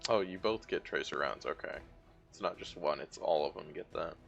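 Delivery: ordinary talk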